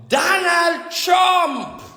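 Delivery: drawn-out prophetic cry of a name; proclaimed shout. Real, not acted. Prophecy rising to a climax.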